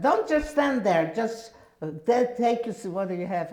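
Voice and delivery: high loud yell that stumbles and fades at the end; yelling again. Retold or imitated. Sister yelling again at the doorstep, impatient. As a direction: shout it again, impatient, tripping over the words. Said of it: "she yelled again at the doorstep".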